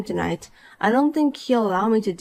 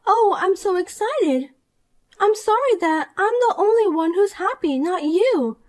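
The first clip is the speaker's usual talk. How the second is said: put-on higher, smug and gleeful young woman; Poppy, gloating; 9 semitones higher than usual. Poppy gloats to the wife about meeting Edward, higher and smug. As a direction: crow over your win like a spoiled brat.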